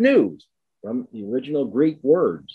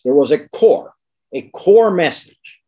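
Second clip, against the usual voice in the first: "core" hit loud, then repeated higher and slower; stress on core; 5 semitones higher, 7 dB louder than usual. Real, not acted. Lecturer marking Graham's central gospel, emphatic stress on "core". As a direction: punch "core" twice, drawing out the second. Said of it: "This I want to stress".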